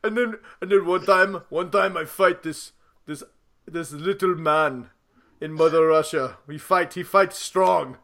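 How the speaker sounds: put-on lightly done Russian strongman accent; a hint of a Russian boxer. Mother Russia, Apollo parody.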